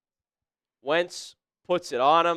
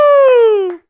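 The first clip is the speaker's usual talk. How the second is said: joyous whoop; 14 dB louder than usual. Real, not acted. Celebrating missed kick.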